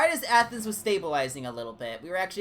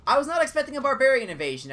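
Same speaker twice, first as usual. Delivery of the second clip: surprised; 10 semitones higher, 8 dB louder, faster than usual. Real, not acted. Surprise barbarian invasion.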